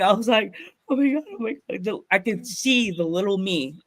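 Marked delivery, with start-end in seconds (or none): 0.9-1.8 high, overwhelmed re-enacted weeping; tearful disbelief. Fan staring at his plaque, imitating tearful high disbelief. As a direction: imitate hyperventilating happy tears. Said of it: "I started crying".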